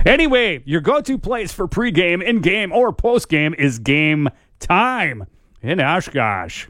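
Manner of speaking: punchy, sing-song sponsor read; radio ad read. Real, not acted. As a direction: sell it like a local radio spot.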